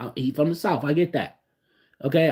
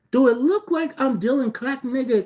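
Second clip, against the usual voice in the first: much higher, indignant retort; offended disbelief; 13 semitones higher, 4 dB louder than usual. Retold or imitated. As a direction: scoff at the insult, voice jumping up. Offended by a stranger's question.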